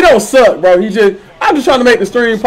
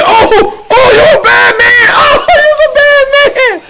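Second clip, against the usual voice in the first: excited shout; slower than usual. Real, not acted. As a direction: yell it out in laughing disbelief. Hype shout at opponent's big play.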